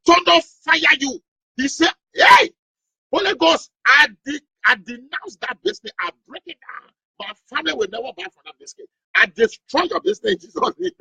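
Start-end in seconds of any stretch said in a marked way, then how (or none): 0.1-1.2 high, slow cursing yell; pastor yelling. Retold or imitated. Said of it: "I dare hear that pastor yelling". 2.1-10.9 high angry preacher rant; furious denunciation. Acted. Pastor denounces casket business.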